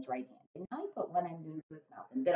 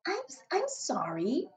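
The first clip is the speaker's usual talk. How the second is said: put-on little girl voice; 10 semitones higher, slower than usual. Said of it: "she said".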